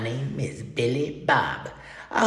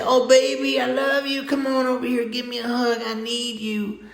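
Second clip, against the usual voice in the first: high, lightly voiced woman pleading; a hint of a woman coaxing her man; a woman's plea; 8 semitones higher than usual. A woman coaxing her partner closer, lightly voiced pleading. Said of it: "if you're a woman, you should say".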